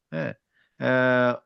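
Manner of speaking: ordinary delivery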